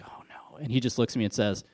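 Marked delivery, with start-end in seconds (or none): 0.0-0.6 breathy whispered oh no of dread. Retold or imitated. Coach spotting the ref he yelled at, whispered dread. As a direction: mutter it under your breath in dread.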